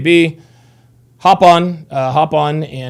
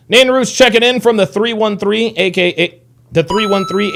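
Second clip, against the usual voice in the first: ordinary talk, nothing marked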